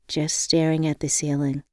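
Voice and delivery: usual speaking voice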